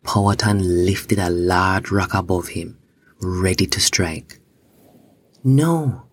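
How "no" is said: higher-pitched narrated cry of protest. A narrator's reading. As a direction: cry out in protest, without full volume. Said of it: "the girl cried out".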